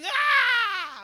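high, loud retold scream of fun; imitated scream. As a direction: belt out a high happy yell. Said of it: "people are screaming and yelling".

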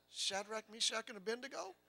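high, soft, questioning call; changed, gentler tone. Retold or imitated. King calling to the men in the furnace, suddenly gentle. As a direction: call the names softly, tentative and rising. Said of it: "then he changes his tone".

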